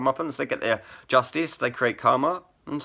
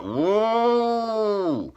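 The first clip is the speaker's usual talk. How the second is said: a much higher, squealing whoop like a siren; imitated prison alarm; 12 semitones higher than usual. Retelling how the prison alarm sounded, a high mock siren whoop. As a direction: mimic the alarm going off. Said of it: "Something like that".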